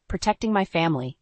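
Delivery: ordinary delivery, nothing marked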